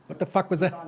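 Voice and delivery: ordinary talk, nothing marked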